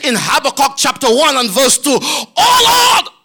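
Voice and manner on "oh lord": high anguished cry; pleading shout of Oh Lord. Acted. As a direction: cry it up to heaven. Preacher voicing prophet's cry.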